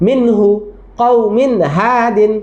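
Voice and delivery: কওমিন হাদিন drawn out very slowly, with the n kept clear; slow drill reading. Shown as a demonstration. Teacher modelling for the children, slow and clear. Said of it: "teacher repeating the drill".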